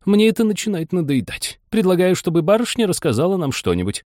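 high-pitched, bored March Hare voice. A narrator's reading. Hare interrupts, bored, with a proposal.

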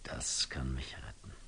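a hushed, relieved whispered discovery; whispered realization. Acted. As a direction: breathe the idea out in secret. Realization in a monologue.

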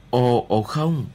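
mild exclaimed protest; exclaiming. A narrator's reading. Remy reacts to Mrs. Milligan's plan, exclaiming. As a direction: cry out a little in protest. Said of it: "tôi kêu lên".

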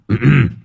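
short awkward throat clear. A narrator's reading. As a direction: clear the throat before speaking. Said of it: "sau đó tằng hắng".

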